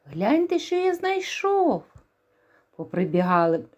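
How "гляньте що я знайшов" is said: high, eager rooster voice; excited rooster. Acted. Rooster shows the mice the ear of wheat, eager high voice. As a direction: show off the find proudly. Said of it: "став гукати півник".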